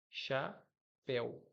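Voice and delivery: "chapéu" partido em cha... péu, com longa pausa; sílaba por sílaba. Shown as a demonstration. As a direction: separe bem devagar as sílabas de "chapéu", péu aberto. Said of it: "é um ditongo aberto em el". ("chapéu" silabado, devagar)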